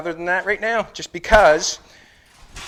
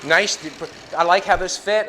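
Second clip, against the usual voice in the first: ordinary delivery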